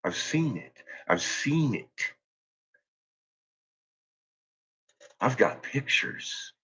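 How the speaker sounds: soft, slow ominous near-whisper; hushed menace. Real, not acted.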